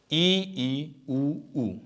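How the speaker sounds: ई and ऊ held with mouth nearly closed; vowel demo. Shown as a demonstration. Teacher showing students the closed vowels ई and ऊ. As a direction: sound ई ई then ऊ ऊ with a narrow mouth. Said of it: "ई, जैसे".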